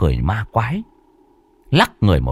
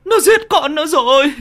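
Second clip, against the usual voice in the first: bitter wailing. A narrator's reading. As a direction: cry it out again, harder. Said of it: "gào lên chua xót".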